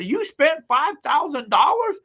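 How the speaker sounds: very high, rising mock wife shriek; hammed-up wife repeating in disbelief; outraged wife. Wife asking again about five thousand, very high mock outrage. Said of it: "She'll say".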